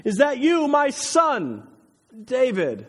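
high, loud, drawn call. A narrator's reading. Saul recognizes David's voice and calls out to him. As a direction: call across the distance to someone half recognized. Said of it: "calls out to him".

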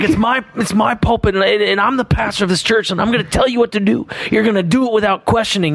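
a half-hearted mock yell, high and tight; imitating a screaming pastor. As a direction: mimic the boss losing his temper, voice up high. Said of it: "he's, like, cussing and screaming".

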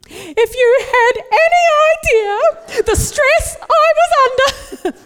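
high, loud, drawn-out overwrought mum; mother speech. Acted. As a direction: deliver a hammed-up, tearful mother's tirade. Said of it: "It starts like this".

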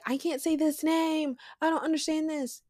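high-pitched imitated complaint; mock stumped reader. Speaker imitates someone stuck on Bible names, high mock complaint. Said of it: "and you be like".

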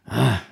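low, brief grumbling groan; frustrated grunt. Acted. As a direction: let out a little frustration. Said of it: "a little frustration".

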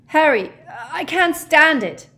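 high, loud lovesick wail; anguished outburst. A narrator's reading. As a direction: cry out as if at breaking point. Lovesick Ron bursts out in anguish.

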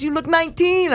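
high put-on lady voice; store lady's voice. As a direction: imitate her amazement up high. Voices lady who remembered him.

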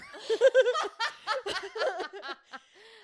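loud, high burst of laughter; real big laugh. Real, not acted. Podcaster cracking up at her own notes, laughing. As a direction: crack up helplessly at full volume.

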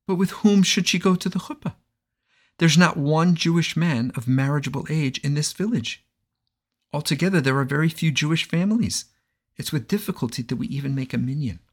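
put-on a worried old innkeeper pleading; yiddish accent.

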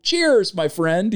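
high-pitched "cheers", dropping after; mock toast. Acted toast quoting The Rock's character.

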